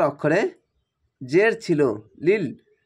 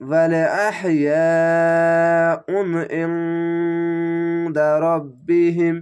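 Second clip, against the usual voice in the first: slow, loud chanted verse, vowels stretched; Quranic recitation; 3 dB louder, much slower than usual. Shown as a demonstration. Teacher gives the full verse as the model reading. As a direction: recite it like a qari in prayer. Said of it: "এভাবে পড়তে হবে".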